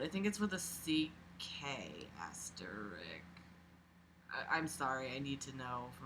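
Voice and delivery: unvoiced, soft whisper to herself; quiet whispered musing; whispered aside. Real, not acted. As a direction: mutter it under the breath. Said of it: "wondering to self".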